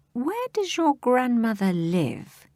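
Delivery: high, slow sugary voice; softest voice. Acted. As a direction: coax her gently, faking kindness. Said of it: "He put on his softest voice".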